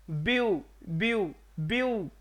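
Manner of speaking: ब्यू sounded alone as one drawn-out beat; syllable demo. Shown as a demonstration. Teacher counting syllables in beautiful, ब्यू said on its own. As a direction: isolate ब्यू, a pause after each. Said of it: "यहाँ पे एक हुआ".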